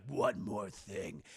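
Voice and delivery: deep, slow villain voice; low, slow mock menace; ominous Electro. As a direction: draw it out very low. Electro speaks after his transformation.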